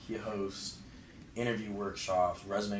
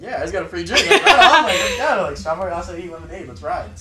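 thrilled and laughing; 10 semitones higher, 17 dB louder than usual. Retold or imitated. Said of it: "I was like".

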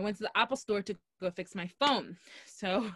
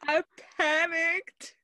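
much higher, drawn-out, amused squeal of words; laughing high confession; 9 semitones higher, 3 dB louder, slower than usual. Real, not acted. Storyteller confesses to friend her blunder, high and drawn-out. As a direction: stretch it out high, on the edge of laughter.

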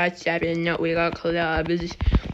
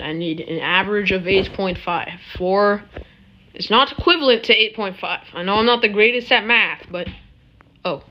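ordinary delivery

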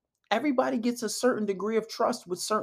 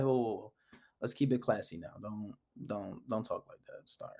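low and soft, fading quieter; easygoing check; 9 semitones lower, 9 dB quieter than usual. Shown as a demonstration. Man checking a girl's first mishap, low-key and soft. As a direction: gently rein her in, voice dropped low. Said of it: "be like".

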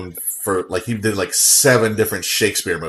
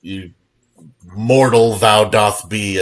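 mock Shakespearean; 5 dB louder, slower than usual. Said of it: "he talked like a Shakespeare character".

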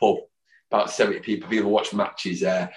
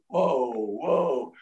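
drawn-out, swooping, higher whoa; awed whoa; 5 semitones higher, 4 dB quieter than usual. Retold or imitated. Fan hears she recognised him, long awed whoa. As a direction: imitate being bowled over, long and high. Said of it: "And I was going".

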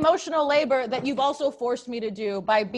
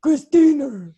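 loud booming name; dramatic loud reveal; 3 semitones higher, 8 dB louder, slower than usual. Acted. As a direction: announce it like a verdict. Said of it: "It's one word".

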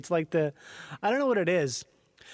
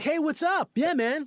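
higher, friendly American bro; American accent; 7 semitones higher than usual. Retold or imitated. Showing how Antipodeans sound doing American, friendly bro American accent. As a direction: imitate a put-on American accent. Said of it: "you're doing an American accent".

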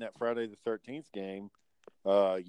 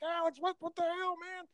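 mock screaming; 6 dB quieter than usual. Girlfriend gamer shot by her partner, mock screaming. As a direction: squeal it in falsetto like a shocked woman. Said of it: "you just hear her screaming".

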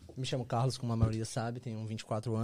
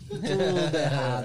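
laughing; 7 dB louder, much slower than usual. Real, not acted. Laughs about failed plan.

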